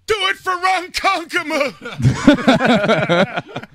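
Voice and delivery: high, very slow, loud stretched yell; mock heckler shout. Retelling Artie's story, a high, very slow mock heckler shout. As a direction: holler it like a rowdy fan behind you.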